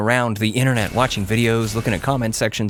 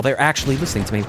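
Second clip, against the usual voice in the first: usual speaking voice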